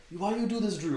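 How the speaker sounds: yelling in a put-on Polish accent. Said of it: "in this Polish voice".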